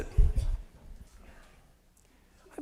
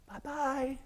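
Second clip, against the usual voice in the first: put-on high, soft little-girl bye-bye; frail little girl; 12 semitones higher, 8 dB quieter than usual. Mascot recalls a sick little girl saying goodbye, frail and soft. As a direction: imitate a sick toddler's tiny farewell, higher and softer. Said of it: "in a frail little voice".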